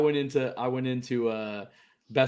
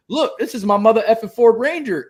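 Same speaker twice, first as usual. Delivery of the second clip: louder and higher punchline; mock TikTok line; 11 semitones higher, 7 dB louder than usual. Pitching his TikTok skit to the clerk, louder and higher. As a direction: deliver it like a big skit line.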